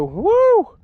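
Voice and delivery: a burst of relief and triumph; high happy whoop. Real, not acted. Angler nearing shore with the fish, happy whoop. Seeing his Tahoe.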